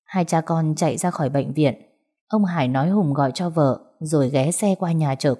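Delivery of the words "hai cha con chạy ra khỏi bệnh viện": ordinary delivery, nothing marked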